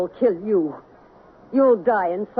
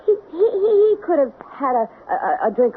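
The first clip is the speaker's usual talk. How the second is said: panicked stammer; 6 semitones higher than usual. Acted. Myra cornered by the detective, stammering in panic. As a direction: stumble over the word in sudden fear.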